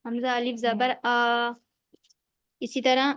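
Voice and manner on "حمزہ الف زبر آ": آ drawn out one alif long, slow; slow recitation, vowel held; stretched madd. Shown as a demonstration. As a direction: hold the آ in حمزہ الف زبر آ for one beat. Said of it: "یہ مدہ ہے".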